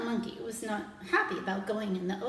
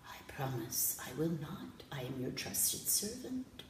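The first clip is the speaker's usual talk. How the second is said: whispered, much softer; whispered promise; 8 semitones lower, 9 dB quieter than usual. Acted. Jellyfish swears to the prince in a whisper. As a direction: whisper the vow like a secret.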